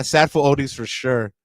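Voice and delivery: normal talking voice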